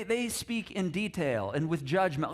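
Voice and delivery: ordinary delivery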